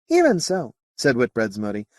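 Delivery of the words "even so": high-pitched alien voice; alien character. Acted. Moti answers Whitbread, high alien voice.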